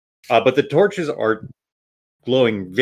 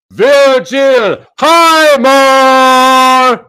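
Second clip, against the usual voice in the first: much louder and higher, drawn out slowly; booming announcer; 12 semitones higher, 10 dB louder, much slower than usual. Acted. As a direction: call the name across the arena. Announcer calls next hero.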